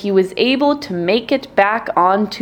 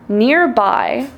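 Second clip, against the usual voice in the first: plain reading voice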